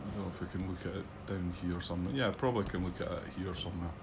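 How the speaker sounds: ordinary talk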